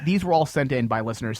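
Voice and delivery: ordinary talk, nothing marked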